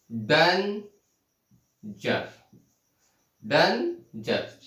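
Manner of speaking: "Danger" very slowly, a long gap between डेन and जर; in two syllables. Shown as a demonstration. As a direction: stretch and split "danger" into two beats. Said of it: "दो मात्राओं का उच्चारण हो रहा है". ("Danger" को दो टुकड़ों में, बहुत धीरे)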